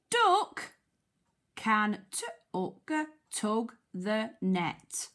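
slow word-by-word phonics reading, "tug" split into sounds; sounding out. Shown as a demonstration. Teacher helping children decode the sentence, sounding out. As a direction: break "tug" into t and uck, then blend it.

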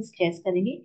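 ordinary talk

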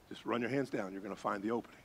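low, very soft instructions; hushed guide. Retold or imitated. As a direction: talk him through it under your breath. Guide instructs in the silent dark.

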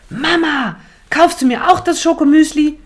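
sehr hoch und laut gebettelt; aufgeregt rufend. Acted. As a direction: aufspringen und begeistert betteln. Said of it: "Leo springt auf und ruft". (Leo bettelt Mama aufgeregt um das Schokomüsli an)

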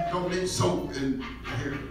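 ordinary talk

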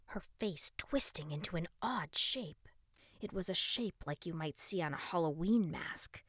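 ordinary delivery

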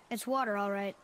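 ordinary delivery

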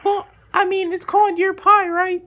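put-on squeaky-high caller voice; high-pitched voice. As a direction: pitch it way up, hesitant on the ums. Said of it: "voice is a little bit high".

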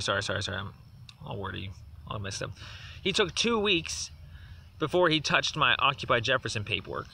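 ordinary talk, nothing marked

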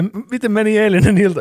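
a hint of a bright kindergarten teacher; daycare staff voice. Daycare question, child answers.

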